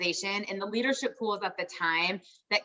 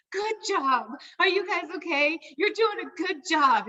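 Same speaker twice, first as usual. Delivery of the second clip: higher, sweet encouraging voice; imitating Cindy; 9 semitones higher than usual. [name] imitating Cindy cheering them on, higher and sweet. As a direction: coo it like a proud mentor. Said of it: "Cindy would just call us and say".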